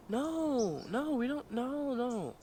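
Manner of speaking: high-pitched imitation of her refusing; scandalized high refusal; mock protest.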